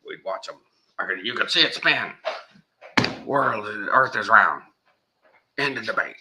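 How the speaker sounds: high, fast mocking blowhard voice; imitating Alex Jones. Mocking Alex Jones bragging about his telescope, high and fast. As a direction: ham up a boastful loudmouth. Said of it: "this is what Alex Jones is saying".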